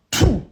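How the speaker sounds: a short loud spitting noise; spit sound. Retold or imitated. Showing viewers how she spat at him, loud mock spit. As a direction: punch out a quick mock spit.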